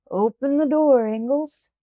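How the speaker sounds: loud demand at the door; a workman calling through the door; called out. A narrator's reading. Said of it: "and one called".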